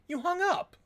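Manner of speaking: high, loud shocked "you hung up"; stunned indignation at being hung up on; indignant disbelief. Acted. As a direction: squeak out the outrage. Caller hung up on.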